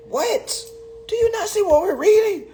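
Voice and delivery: very high-pitched, squeaky disbelief; incredulous outburst. Acted. Reader to listeners about what we're reading, high-pitched disbelief. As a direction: squeak it out, astonished.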